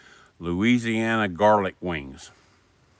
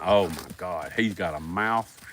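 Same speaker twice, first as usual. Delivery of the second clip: normal talking voice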